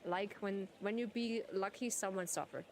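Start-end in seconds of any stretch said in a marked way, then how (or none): none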